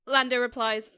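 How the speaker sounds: ordinary delivery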